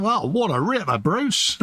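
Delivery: high hammed-up Aussie slang; hammed-up Australian accent; mock Australian. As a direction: play the Aussie bloke for laughs. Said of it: "You went Australian then".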